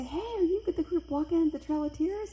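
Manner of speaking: acted-out cheerful hail; retold friendly hail. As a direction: call out to passing walkers. Archaeologists hail the walkers, retold.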